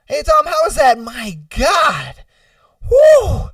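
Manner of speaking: high, loud strained small talk; mock agony. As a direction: chat through a maddening itch, ending in a whoop. Itchy person struggling through small talk.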